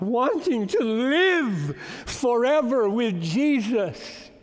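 slow climb to a ringing finish; building emphasis. Real, not acted. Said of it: "You got that?".

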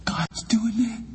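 hushed high whisper. Shown as a demonstration. Preacher to congregation, first take whispered. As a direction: breathe it out as a secret. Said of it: "repeats line, first hushed".

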